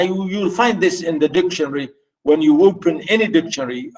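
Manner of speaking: ordinary delivery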